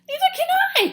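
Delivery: very high, loud howl. Acted. Flitter lost in the dark, howling. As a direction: howl in fright. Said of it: "howled Flitter".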